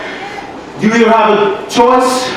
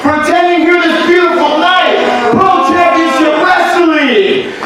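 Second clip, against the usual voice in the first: lucha libre announcer; 5 semitones higher than usual. Acted. In-ring announcer addressing crowd.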